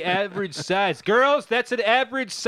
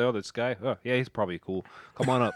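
low, casual guard voice; a lightly voiced bored guard; lazy guard voice; 9 semitones lower, 5 dB quieter, much faster than usual. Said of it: "I can imagine somebody".

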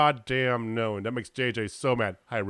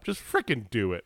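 ordinary talk, nothing marked

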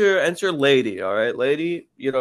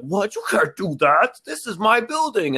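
much higher, indignant boss voice; mock outraged boss; 8 semitones higher than usual. Boss protesting the secretary's buyout, in a high indignant squeal. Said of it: "He was like".

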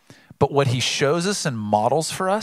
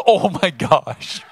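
higher and louder, slow, laughing through it; giggly toddler imitation; 5 semitones higher, 5 dB louder, much slower than usual. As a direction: mimic the little one giggling back at dad. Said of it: "She goes".

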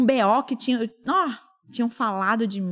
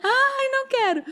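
high, slow, whiny mock cry; mock whining; 14 semitones higher than usual. Teasing her old dread of homework, a high whiny mock cry. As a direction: whimper it like a kid dodging homework.

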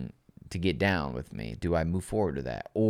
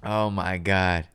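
ordinary talk, nothing marked

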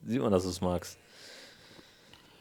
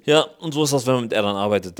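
spöttisch; 2 semitones higher, 8 dB louder than usual. Real, not acted. Neckt den Freund.

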